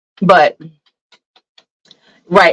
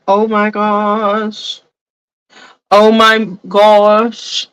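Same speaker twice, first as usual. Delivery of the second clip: very slow, drawn-out fake rapture; mock swooning reviewer; much slower than usual. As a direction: stretch each word like an over-the-top perfume influencer. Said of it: "I'm not gonna be doing the whole".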